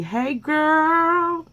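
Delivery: high, bright sing-song hello; excited greeting. Real, not acted. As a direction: call out to your friend like you're thrilled to see her. Greeting her pen pal on camera.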